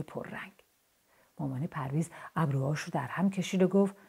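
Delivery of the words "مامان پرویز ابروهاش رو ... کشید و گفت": usual speaking voice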